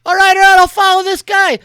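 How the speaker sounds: high, loud, exasperated agreement; Lux's voice. Acted. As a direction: give in loudly. Lux answers as pilot.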